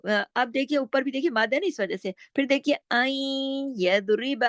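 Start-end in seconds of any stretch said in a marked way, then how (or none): 2.9-4.4 आई held long through the nose before यदरिब; slowly recite, letting the n of आई melt into a nasal glide; partial nasal merging. Shown as a demonstration. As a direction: stretch the nasal sound in आई. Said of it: "इदगाम नाकिस हो रहा है".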